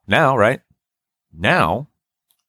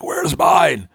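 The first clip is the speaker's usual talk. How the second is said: whiny demand; 5 semitones higher than usual. Retold or imitated. Friend demanding his missing food.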